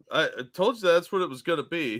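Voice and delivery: usual speaking voice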